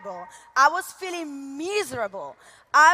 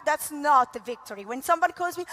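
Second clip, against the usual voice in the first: normal talking voice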